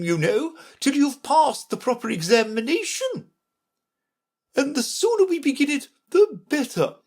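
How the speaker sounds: very high-pitched, scolding queen; imperious Red Queen. Acted. Red Queen questioning Alice's title, high and stern. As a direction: demand and lecture from the top of the voice.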